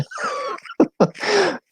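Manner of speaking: real high giggling laugh; amused laugh. Real, not acted. Finds a New Englander's howdy funny, high giggle. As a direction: giggle helplessly at your own remark.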